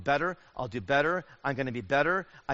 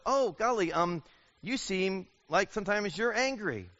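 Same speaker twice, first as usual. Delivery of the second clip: gentle, careful spouse, higher and slower; very nicely; 6 semitones higher than usual. Retold or imitated. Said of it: "the spouse goes very nicely".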